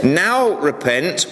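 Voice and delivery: "Now repent." drawn out slowly, each word weighted; slow it right down and press "Now repent."; slow emphatic scripture quote. Shown as a demonstration. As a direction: deliver the command like a solemn warning. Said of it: "this verse says".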